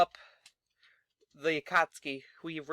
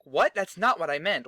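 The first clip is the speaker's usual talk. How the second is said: loud, startled what; stunned wrestler protesting; shocked protest; 3 semitones higher, 7 dB louder than usual. Retold or imitated. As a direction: blurt it out in disbelief. Colon reacts to Sting's booking.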